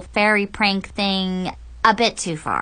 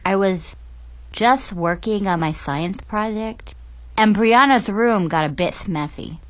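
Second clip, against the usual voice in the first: snorkel voice; 2 semitones lower than usual. Acted. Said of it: "with a really wicked lisp".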